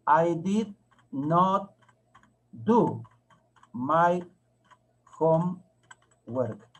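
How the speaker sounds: very slow, one word at a time; teacher spelling out a sentence word by word; slow dictation. Shown as a demonstration. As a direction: stretch each word, long pause after it. Teacher dictates sentence while writing it.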